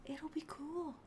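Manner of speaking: hushed, breathy sneaking whisper; sneaky whisper. Acted. David whispering to his men while sneaking away. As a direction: whisper it like you're slipping out unseen.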